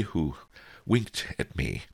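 ordinary delivery

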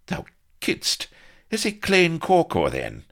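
high, loud disbelieving question; amazed question. A narrator's reading. Blood asking Vanity whether Pugh is mad, amazed. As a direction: ask if he's truly mad.

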